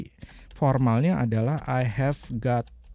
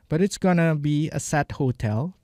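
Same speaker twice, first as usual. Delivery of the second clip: slow repeat; 3 semitones higher, slower than usual. Shown as a demonstration. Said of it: "Going to jadi gonna".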